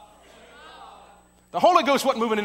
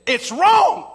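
sharp raised cry of protest, high and loud; loud complaint; 9 semitones higher, 8 dB louder than usual. Retold or imitated. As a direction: call it out loud like a scandal. Guest complains loudly at dinner.

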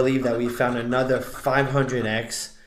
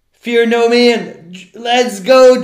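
slow, loud hype-up yell; hype shout; 8 semitones higher, 12 dB louder, much slower than usual. Real, not acted. Streamer pumping up chat before the scratch, loud slow hype. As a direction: psych yourself up, drawn out and loud.

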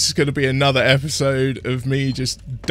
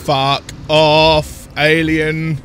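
loud outburst; 2 semitones higher, 9 dB louder, much slower than usual. Real, not acted. Alien attacks the player.

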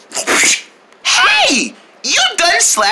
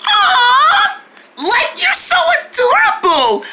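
cooing; 12 semitones higher than usual. Acted. Cooing over the baby gator.

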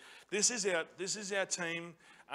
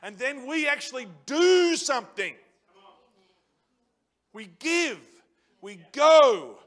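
louder, higher preaching call; rousing sermon; 5 semitones higher, 9 dB louder than usual. Acted. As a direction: drive the call to action home. Preacher builds to loud call.

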